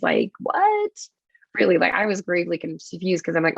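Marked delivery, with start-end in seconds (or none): 0.0-0.9 jumps way higher in disbelief; baffled. Retold or imitated. Retelling baffled thoughts at the rally, high incredulous squeak. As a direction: squeak it out, totally thrown. Baffled at old folks' picnic.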